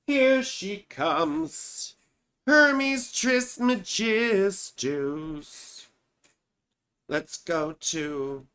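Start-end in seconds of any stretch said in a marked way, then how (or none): 0.1-5.9 grand announcement. Pretend.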